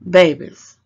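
a sudden loud "Babies", falling at the end; loud exclamation. Real, not acted. Calling out to her viewers after a pause, suddenly loud. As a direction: burst out with it after the silence.

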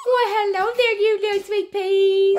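high, loud sweet greeting; gushing, high-pitched puppy talk. Real, not acted. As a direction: coo a big hello to little puppies. Greets puppies in baby talk.

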